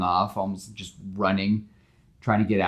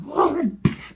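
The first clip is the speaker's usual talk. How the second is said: burst of laughter; 6 dB louder than usual. Real, not acted. Laugh during retelling.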